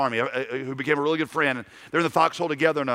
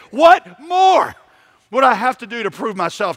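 God's shout; 7 semitones higher, 8 dB louder than usual. Acted. God's imagined voice rebuking preacher.

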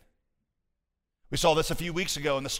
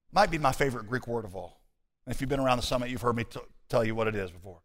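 ordinary talk

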